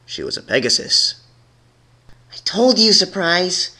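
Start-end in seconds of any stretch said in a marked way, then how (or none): 2.3-3.7 angry snap. A narrator's reading. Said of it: "snapped the angry Pink Mare".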